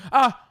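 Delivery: sudden startled yelp; high startled cry; sharp yelp. Real, not acted. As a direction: yelp as the game goes wrong. Game death in stream.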